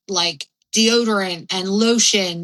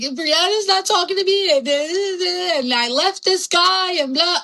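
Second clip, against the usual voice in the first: whiny self-mockery; 10 semitones higher than usual. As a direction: whine it out like a sulky kid. Mocking her own past whining.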